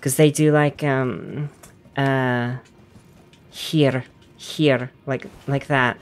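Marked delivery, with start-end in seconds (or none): 3.5-4.9 "here" with a lightly rolled Icelandic R at the end; rolled final R. Shown as a demonstration. Gamer showing Icelandic ending, "here" with rolled R. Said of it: "They kind of roll their R's a little bit".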